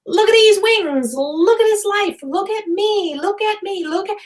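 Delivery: high, delighted show-off voice of the mom; mom's excited voice. Retold or imitated. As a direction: squeal it out like a proud, giddy mom. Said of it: "and she's like".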